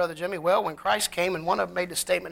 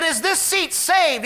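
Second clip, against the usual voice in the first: louder, higher repeat of the question; raised voice repeating for a man who missed it; 8 semitones higher, 5 dB louder, slower than usual. Retold or imitated. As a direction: say it again so he hears this time. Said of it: "He said, 'What?'".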